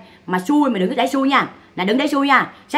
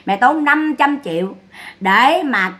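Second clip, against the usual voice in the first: normal talking voice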